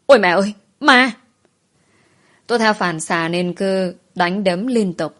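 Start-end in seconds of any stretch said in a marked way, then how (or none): 0.0-1.1 startled shriek, high and loud; sợ hãi hét lên; frightened scream. A narrator's reading. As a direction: scream in sudden fright at a ghost. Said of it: "Tôi giật mình rồi hét lên".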